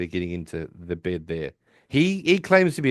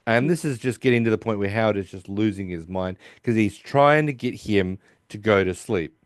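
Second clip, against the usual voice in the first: ordinary talk, nothing marked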